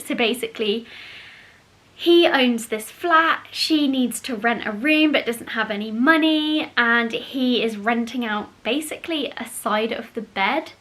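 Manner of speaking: usual speaking voice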